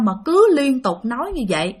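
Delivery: ordinary talk, nothing marked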